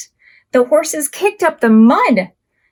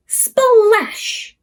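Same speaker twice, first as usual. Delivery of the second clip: splashy sound effect; 9 semitones higher than usual. Acted.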